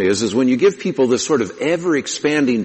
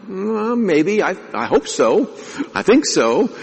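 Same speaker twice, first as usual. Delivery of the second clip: higher, unsure imitation of a hedging unbeliever; lightly mocking, doubtful "I hope so"; hesitant nonbeliever; 10 semitones higher, slower than usual. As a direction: pitch it up and hedge, hoping it's enough. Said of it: "they'll say".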